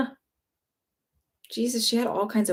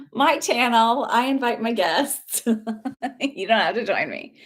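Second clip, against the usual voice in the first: real laughter through the words; laughing. Real, not acted. Host tells viewer it's her channel, laughing. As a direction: laugh as you brush them off.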